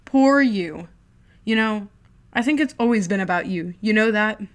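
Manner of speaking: loud sneering taunt; mock him cruelly; older brother mocking loudly.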